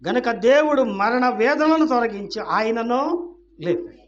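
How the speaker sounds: loud, high preacher's proclamation; full-force preaching. Real, not acted. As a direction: proclaim it to the whole congregation.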